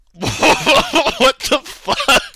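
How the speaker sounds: high, loud and drawn out very slowly; stunned disbelief. Retold or imitated. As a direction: stretch it out as if you can't believe what you read. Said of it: "I'm like".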